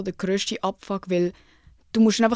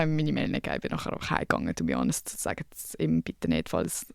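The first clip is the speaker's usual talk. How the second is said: a low whisper, much softer; whispered aside; 8 dB quieter than usual. Real, not acted. Asking viewers to keep it secret, whispered low. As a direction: confide it under your breath.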